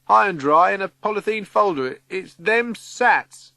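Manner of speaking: shouting down. A narrator's reading. Cyril calling from a high cupboard to Vi, shouting. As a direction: call the good news down. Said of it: "Cyril, shouts down".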